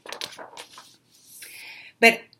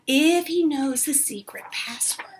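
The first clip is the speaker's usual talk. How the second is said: hushed secretive whisper; soft whispered secret; 3 semitones higher, 4 dB quieter than usual. Acted. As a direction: breathe it like a secret. Tia Mia whispers the password secret.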